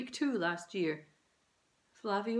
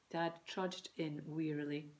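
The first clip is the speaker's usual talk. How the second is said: ordinary delivery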